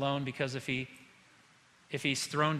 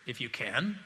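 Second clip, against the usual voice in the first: quiet, lower, disbelieving repeat of can; incredulous echo; 5 semitones lower, 4 dB quieter, slower than usual. Acted. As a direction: echo "If you can" back softly in disbelief, rising on "can". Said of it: "I think that's exactly how he said it too".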